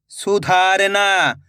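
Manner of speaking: slow, drawn-out blending of the word; slow clear reading. Shown as a demonstration. Teacher to pupils, blending the word slowly. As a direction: stretch each syllable for the pupils. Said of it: "मैं किस तरीके से एक एक अक्षर का उच्चारण".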